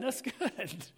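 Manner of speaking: a soft laugh breaking into the words. Real, not acted. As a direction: chuckle through it, pleased with the room. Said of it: "they're laughing now".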